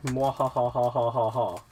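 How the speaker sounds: mock villain cackle mwahaha; hammed-up evil laugh; mad scientist cackle. Evil laugh after boast.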